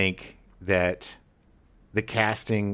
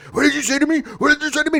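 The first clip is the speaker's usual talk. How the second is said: startled defensive man; 4 dB louder, much faster than usual. Retold or imitated. Actor's character confronts fan.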